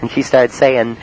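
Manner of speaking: usual speaking voice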